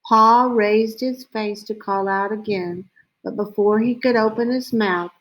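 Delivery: ordinary delivery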